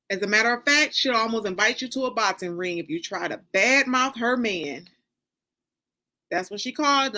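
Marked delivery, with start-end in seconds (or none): none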